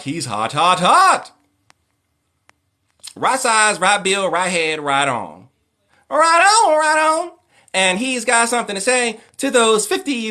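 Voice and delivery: high, loud, hyped-up radio host squeal; Chris Tucker as radio host. Retold or imitated. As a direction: shriek it like a flamboyant showbiz DJ. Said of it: "I loved Chris Tucker".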